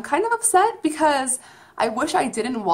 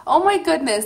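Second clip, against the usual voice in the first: overjoyed gush; 4 semitones higher, slower than usual. Real, not acted. After performance ends, overjoyed.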